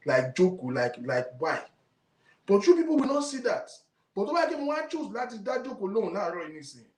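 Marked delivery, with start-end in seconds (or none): 2.5-6.9 exasperated rant. Real, not acted. Angry rant at the audience.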